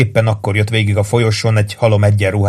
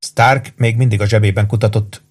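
plain reading voice

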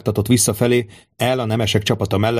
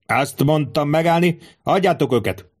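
higher-pitched firm order; insistent command; 6 semitones higher than usual. A narrator's reading. Centurion restrains the bodyguards, insistent command. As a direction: repeat the order insistently, without full volume.

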